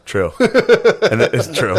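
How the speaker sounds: real laugh spilling over the words. Real, not acted. Friend jokes about boys, he answers laughing.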